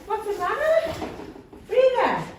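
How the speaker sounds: high, sarcastic parroting; mocking echo. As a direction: throw her question back at her. Patient mocking the question.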